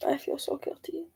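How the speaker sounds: whispered, soft confession; guilty whisper. Real, not acted. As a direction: admit it under your breath. Confessing guilt after sad news.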